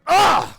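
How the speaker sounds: loud, high-pitched groan of disgust; disgusted ugh. Acted. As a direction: recoil at the gross thought. Disgust at burrowing parasites.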